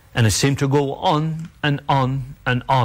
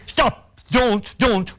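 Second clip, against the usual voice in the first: urgent plea; 9 semitones higher, much slower than usual. Retold or imitated. Mother stopping uncle at door.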